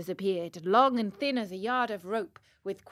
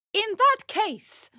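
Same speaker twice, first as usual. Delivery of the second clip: high, loud, slow puppet voice; proud declaration; 10 semitones higher, 7 dB louder than usual. Acted. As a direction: announce it grandly, high and ringing. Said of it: "said Pinocchio proudly".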